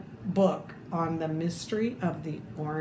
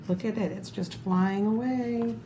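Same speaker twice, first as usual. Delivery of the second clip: ordinary delivery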